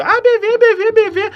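high, loud taunting chant of B V; mocking boys. Boys taunting the narrator, mocking high chant. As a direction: schoolboys teasing a shy kid. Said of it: "os guri vão dizer".

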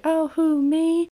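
high, mock-innocent creepy voice; creepy fake innocence. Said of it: "it's a little bit higher pitch".

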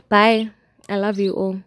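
usual speaking voice

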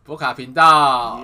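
loud, slow, drawn-out channel name; excited show intro. Acted. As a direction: announce it like a show host.

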